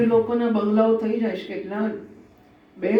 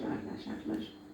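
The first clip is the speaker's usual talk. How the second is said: mock whisper; 16 dB quieter than usual. Mimicking a bank-balance boaster.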